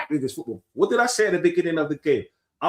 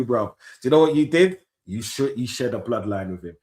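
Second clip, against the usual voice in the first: normal talking voice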